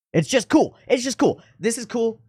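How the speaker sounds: giddy fan gushing over a scene; excited delight. Real, not acted. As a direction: squeal it out like a fan. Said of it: "taps into something emotionally".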